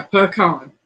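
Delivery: "pecan" said puh-KAHN. Shown as a demonstration. Host insisting on their pronunciation, puh-KAHN. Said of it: "how do you pronounce it".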